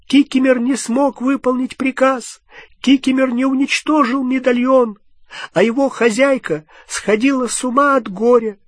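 high elf voice, stammering through tears; breaking down as he confesses; weeping elf, stuttering at the end; sobbing house-elf. A narrator's reading. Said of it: "Кикимер зарыдал так".

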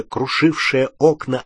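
ordinary delivery

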